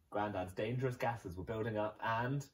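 plain reading voice

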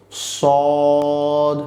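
সদ held long with rounded lips. Shown as a demonstration. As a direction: round the mouth and stretch সদ. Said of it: "ঠোঁটকে একটু গোল করে আমরা বলবো". (ঠোঁট গোল করে সদ)

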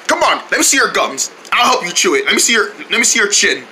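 normal talking voice

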